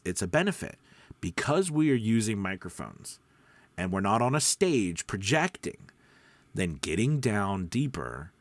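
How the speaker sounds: ordinary talk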